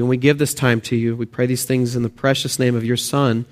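usual speaking voice

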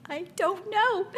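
put-on high, slow little-girl "I don't know" through a laugh; toddler voice. Mother imitates her toddler daughter answering: high, slow, giggly. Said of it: "And then she's like".

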